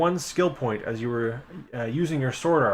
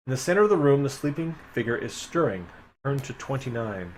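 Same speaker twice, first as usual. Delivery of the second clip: normal talking voice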